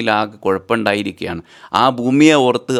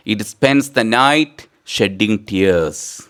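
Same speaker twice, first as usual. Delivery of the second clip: slow dictation; much slower than usual. Shown as a demonstration.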